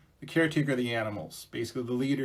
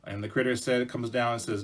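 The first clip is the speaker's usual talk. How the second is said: usual speaking voice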